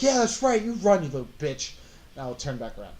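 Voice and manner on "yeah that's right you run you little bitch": loud, high jeer at a fleeing foe; in-character taunt. Acted. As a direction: call it after the runner as a taunt. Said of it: "he can at least hear me".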